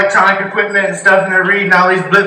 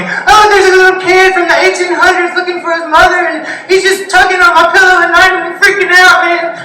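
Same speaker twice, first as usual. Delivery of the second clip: much higher, whiny mock voice; mocking gullible believer; 11 semitones higher than usual. Comic mocking people who told him ghost stories, high whiny mock voice. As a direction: parody a spooked believer. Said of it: "people were telling me".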